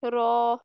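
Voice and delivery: रॉ said thick with the tongue tip on the gums; full heavy रॉ sound; heavy raa demo. Shown as a demonstration. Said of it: "pronounce होता है रॉ".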